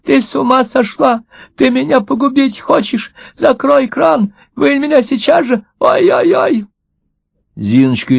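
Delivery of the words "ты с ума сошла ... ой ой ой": very high, frantic fish voice; high-pitched alarm from a tiny creature; panicked cry. A narrator's reading. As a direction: squeal desperate orders as if in danger. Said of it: "крикнула рыбка".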